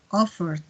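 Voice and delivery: "offered" ending in a soft d, almost t; soft final D. Shown as a demonstration. Teacher drills past forms, "offered" with soft final stop. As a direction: close "offered" on a gentle stop. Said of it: "pero más suavecita".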